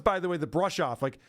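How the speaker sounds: ordinary talk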